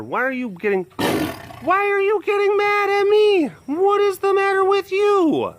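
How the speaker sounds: concerned high coo for a cranky cat; pet talk. Real, not acted. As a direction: fuss over her in a high, coaxing squeal. Fussing over a grumpy cat.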